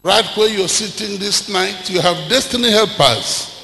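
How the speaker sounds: loud rising sermon cries over music; preaching at full force. Acted. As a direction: build the sermon up to a shout.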